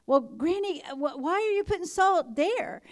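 put-on high, puzzled little boy voice; curious grandson. Boy asking his granny about salt, high and puzzled. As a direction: voice the boy, pitch up and wondering.

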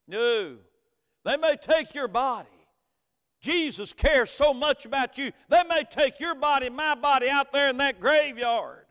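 loud, high preaching climb; full-force preaching. Acted. Pastor preaching hope at a funeral, loud and high. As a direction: preach it out to the congregation.

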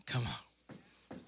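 low hushed whisper; a preacher whispering "come on" to the church. Real, not acted. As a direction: breathe it out to the crowd. Whispered aside to congregation.

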